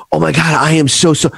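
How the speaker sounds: high, excited flustered gush; starstruck gush. Retold or imitated. Bumping into a celebrity at midnight, high starstruck gush.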